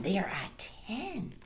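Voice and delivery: slow whispered count. Real, not acted. Reading to children, slow whisper. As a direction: whisper the number slowly.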